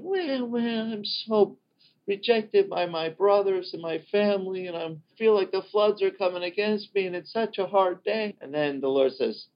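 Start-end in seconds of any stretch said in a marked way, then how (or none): 0.0-8.4 high, whiny, self-pitying complaint; whiny mock lament. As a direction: complain in a sing-song whine of self-pity. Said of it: "So David's going".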